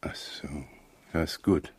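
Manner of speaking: breathy whispered realisation; soft aside to the constable. Acted. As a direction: whisper it so the suspect won't hear. Inspector aside, hearing secret report.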